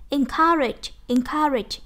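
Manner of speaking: "Encourage" stressed on COUR, then falling; second-syllable stress. Shown as a demonstration. Teacher drilling a word for students, stress on second syllable of "Encourage". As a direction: hit COUR in "Encourage" as a model. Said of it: "nhấn âm hai".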